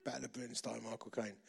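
low, soft voice in the head; understated voice nagging inside your head; retold inner voice. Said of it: "this little inner voice going".

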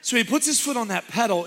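ordinary talk, nothing marked

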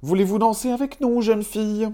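very high, fast little dwarf voices, the last words whispered; high, quick dwarf chorus; dwarves' voice. A narrator's reading. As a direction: squeak out the invitation like tiny dwarves. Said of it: "et lui dirent".

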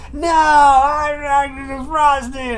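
put-on high, drawn-out toddler wail; slow imitated tantrum cry; mock crying. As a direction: bawl the words into mush. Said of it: "Stop crying so I can understand you".

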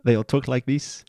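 mild mock South African accent; a light South African impression. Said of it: "South African".

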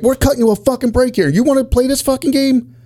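high-pitched retold tirade; mock angry scolding. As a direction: imitate an exasperated cop laying down the law. Quoting partner scolding the guy.

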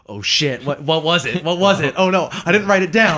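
mild mock panic, rising as it goes; retold panic. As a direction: act out a flustered scramble to remember. Acts out own panic at forgetting.

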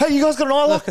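high and loud, mock panicked question; hammed-up urgent squeak; frantic high ask. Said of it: "It's like".

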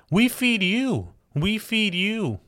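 high, drawn-out, emphatic correction; stretched, high-pitched indignation; indignant retort. Real, not acted. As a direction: throw it back at her, high and slow. Indignant retort to the quoted politician.

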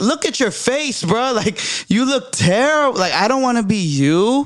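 incredulous retort. Retold or imitated. Mocking retort to boss.

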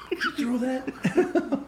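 high, slow hammed-up accusation with laughter; mock interrogation. Co-host pretending to grab a kid, mock-angry high yell. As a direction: play-act furiously confronting a kid, drawn out. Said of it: "grab a kid by her shirt".

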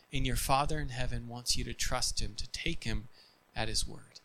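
ordinary delivery

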